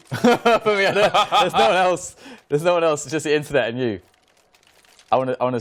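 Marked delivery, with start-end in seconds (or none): 0.1-3.0 laughing, louder and higher; laughing outburst. Real, not acted. To co-caster, laughing loud that no one else is here. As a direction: break into laughter while protesting.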